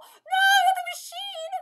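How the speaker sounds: high, loud, drawn-out cry; amused, high-pitched protest; dismayed wail. Real, not acted. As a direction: wail in over-the-top dismay. Mock dismay at the story.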